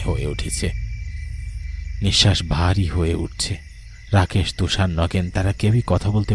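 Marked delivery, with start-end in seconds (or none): none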